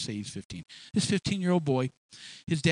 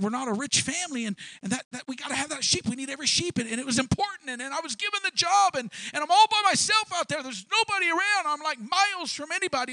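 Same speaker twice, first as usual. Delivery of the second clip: high, eager pleading; David begging King Saul to believe him, high and earnest; pleading young David; 8 semitones higher than usual. Retold or imitated. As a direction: rush the story out to the king, voice climbing. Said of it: "I can just put it in my own words".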